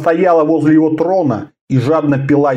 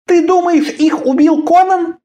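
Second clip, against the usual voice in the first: surprised. Acted. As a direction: ask in shocked disbelief. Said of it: "удивлённо спросила Карелла".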